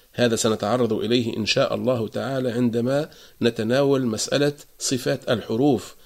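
ordinary delivery

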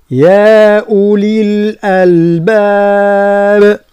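slow, drawn-out tajweed chant; Quran recitation. Shown as a demonstration. Teacher recites the verse for students, slow chant. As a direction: recite it long and steady.

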